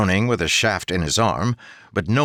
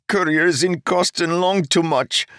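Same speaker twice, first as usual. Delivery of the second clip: high, subdued youthful voice; muted Keeg; 11 semitones higher than usual. Acted. Young bridgeman answering Jarek, muted and dejected. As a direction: mumble the excuse like a dejected boy. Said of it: "Keeg replied mutedly".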